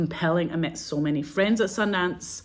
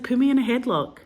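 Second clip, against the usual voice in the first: imitating Ted's request; 8 semitones higher, 5 dB louder than usual. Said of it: "He was like".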